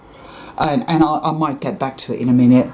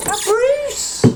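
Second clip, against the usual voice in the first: very high delighted call; surprised call to the cat. Real, not acted. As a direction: sing out to a cat you spot. Calling out to the neighbor's cat.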